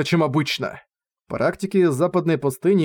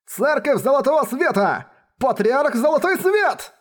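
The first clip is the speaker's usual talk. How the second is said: much higher, drawn-out cry; excited shout; 10 semitones higher than usual. A narrator's reading. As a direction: cry out the name in awe. Crowd shouts the name.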